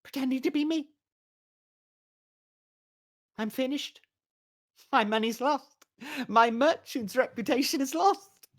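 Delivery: high, fretful merchant's wail; a high fretful merchant lamenting lost money; whining merchant. Acted. Doppler whines as the merchant.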